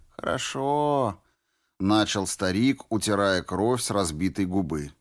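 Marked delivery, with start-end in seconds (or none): 0.1-1.2 put-on slow, creaky old man. A narrator's reading. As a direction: drawl it like an old tramp. Old tramp voiced in story.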